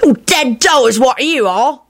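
put-on high, drawn-out boyish whine; whining. A narrator's reading. Victor sulking at Remus, whining. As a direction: complain slowly in a high whine. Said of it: "Victor whined".

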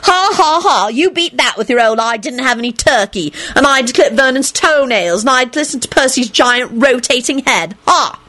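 high, gloating triumph; ecstatic crowing. A narrator's reading. Elizabeth gloats that his story beats theirs, ecstatic. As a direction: crow over them in a high voice. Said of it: "Elizabeth shrieked ecstatically".

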